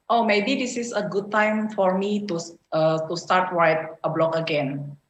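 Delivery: normal talking voice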